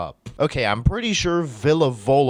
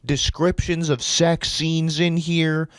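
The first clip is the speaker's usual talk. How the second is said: loud, raised-pitch disbelief; incredulous rant; 5 semitones higher, 5 dB louder than usual. Real, not acted. Reader ranting at the story's sudden censoring, incredulous. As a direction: rant as if you cannot believe what you read.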